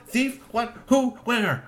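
startled voices. Acted. Kitchen waking in alarm.

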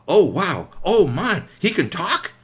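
put-on a child who can't believe it; amazed child. Child amazed the robot talks.

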